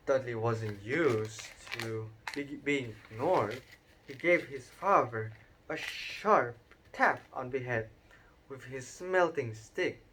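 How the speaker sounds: ordinary delivery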